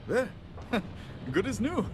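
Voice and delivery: proud ringing declaration; triumphant. Real, not acted. To the customer after the repair, proud and triumphant. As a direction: show off the fixed machine.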